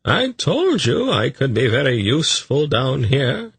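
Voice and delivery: high, self-satisfied character voice; self-congratulatory. A narrator's reading. Tzadi claiming he is useful, self-congratulatory. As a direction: pat yourself on the back, pitched high. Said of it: "in a self-congratulatory manner".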